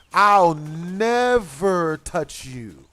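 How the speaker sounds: very slow, stretched-out mock promise; drawn-out vow. Telling the accusing woman he got her one better, drawn-out vow. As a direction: drag out the key word for effect. Acted comeback to her yelling.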